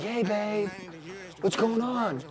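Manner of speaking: barely any energy left for her; drained at home. Retold or imitated. Low energy at home with girl.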